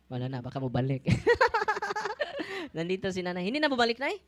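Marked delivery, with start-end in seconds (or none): 1.1-2.7 high delighted laugh. Real, not acted. Laughing with congregation.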